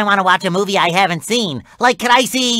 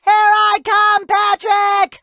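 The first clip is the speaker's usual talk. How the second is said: high rallying shout; slow, high call to arms; heroic battle cry; 9 semitones higher, slower than usual. Acted. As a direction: charge in yelling. Charging in to rescue Patrick.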